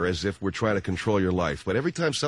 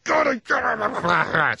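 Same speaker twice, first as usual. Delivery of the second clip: angry; 12 semitones higher, slower than usual. Retold or imitated. Husband yelling at lost wife to get on MapQuest, angry shout. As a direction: bark it out, losing patience. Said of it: "now I'm getting angry".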